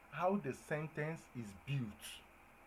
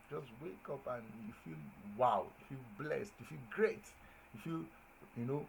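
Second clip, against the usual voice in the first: ordinary talk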